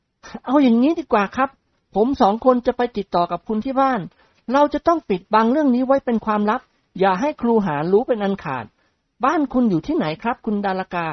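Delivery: eager suitor voice. Acted. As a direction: coax her eagerly. Nikorn plans secret visit. (เสียงชายหนุ่มกระตือรือร้นเกลี้ยกล่อม)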